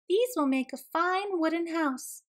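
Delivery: a touch of little-animal pleasure; little dassie. A narrator's reading. Second dassie's line in story.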